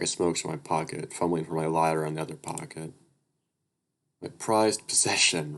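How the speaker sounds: steady read-aloud narrator voice; even storytelling read; story narration. A narrator's reading.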